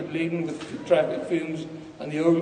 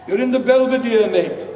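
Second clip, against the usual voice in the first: higher, louder reply to a befuddled drinker; raised conversational voice; 8 semitones higher, 8 dB louder than usual. Acted. Dave to the lost old fellow in the bog, raised voice. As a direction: voice it up, as if making a drunk understand. Tells lost drunk where he is.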